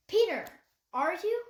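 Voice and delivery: a hint of a young girl, pressing gently; girl putting it together aloud; lightly voiced girl.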